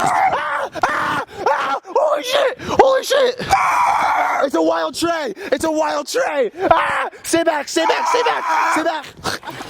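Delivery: high, shrieking mock panic; panicked screaming. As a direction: shriek as if a beast charges. Pretend panic at wild animal.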